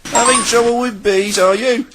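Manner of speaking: high, jokey engine voice; Henry. A narrator's reading. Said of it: "laughed Henry".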